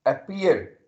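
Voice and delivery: "appear" with the P punched; stress on P. Shown as a demonstration. Teacher demonstrating a stressed letter, strong P in "appear". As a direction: lean hard into the P of "appear". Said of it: "the letter P is being stressed".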